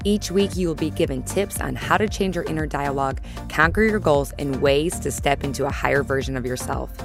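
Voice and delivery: upbeat announcer read over music; podcast intro read. Acted. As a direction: read it like a polished show intro.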